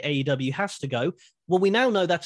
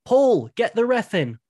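mock shout; 7 semitones higher, 4 dB louder, slower than usual. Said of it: "Roman Reigns shouts to Heyman".

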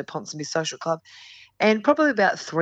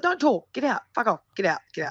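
higher-pitched, cut-him-off orders without shouting; retold angry dismissal; 5 semitones higher than usual. Storyteller replaying throwing out the married lover, curt and angry. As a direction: imitate firing off short orders to leave.

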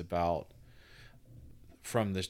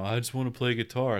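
quick, raised kid voice, lightly acted; hint of a keen young son; eager kid voice; 4 semitones higher, faster than usual. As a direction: toss it off like an impatient kid. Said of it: "you know".

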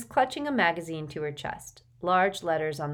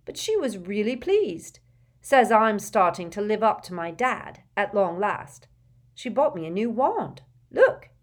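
Neville's voice; 4 semitones higher, 6 dB louder than usual. A narrator's reading. Neville proudly tells about his gran.

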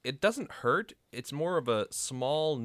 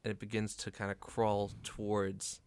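hushed, slow game master narration; suspenseful scene setting; 6 dB quieter than usual. Acted. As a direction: let the creature creep in, softly, with long pauses.

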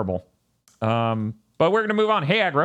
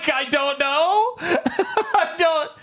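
high, giggly laughing talk; much slower than usual. Real, not acted. Laughing at the Game Pass answer.

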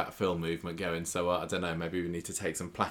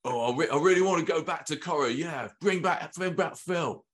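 higher-pitched put-on impression of an actor's everyday voice; Jamie impression; 7 semitones higher than usual. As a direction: do a light, higher mimicking voice. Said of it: "of my non-Philish voice from you".

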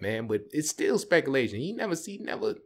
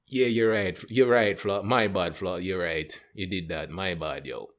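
put-on sheepish Jamaican accent, sinking lower; Jamaican accent, caving in; 6 semitones lower than usual. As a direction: give in with a Jamaican accent, tailing off. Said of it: "he just caved in".

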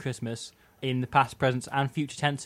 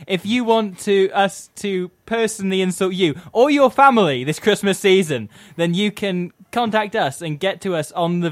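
higher, louder words through laughter; laughing while talking; 7 semitones higher, 11 dB louder than usual. Real, not acted. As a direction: still giggling as you speak.

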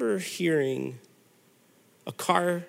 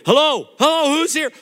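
startled homeowner; 8 semitones higher, 7 dB louder than usual. Retold or imitated. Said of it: "you hear the guy go".

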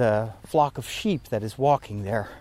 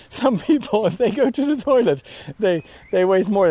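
higher, giggling through the words; laughing; 8 semitones higher, 5 dB louder than usual. Real, not acted. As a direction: chuckle through it, amused at himself. Laughing at his own toilet joke.

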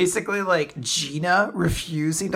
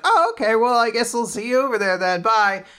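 cheerful Chikara; 7 semitones higher, 4 dB louder than usual. Retold or imitated.

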